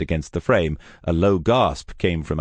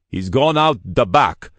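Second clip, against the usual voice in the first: louder, higher warning call; urgent warning; 7 semitones higher, 5 dB louder than usual. Acted. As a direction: alert the boss fast. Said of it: "he warned Kruglov".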